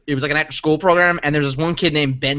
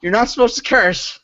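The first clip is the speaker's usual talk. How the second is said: scolding; 6 semitones higher than usual. Retold or imitated. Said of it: "I was like".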